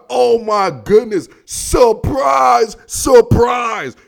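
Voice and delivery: loud, drawn-out sarcastic amazement; mock surprise. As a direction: shout it slowly in feigned astonishment. Sarcastic reaction to predictable Packers win.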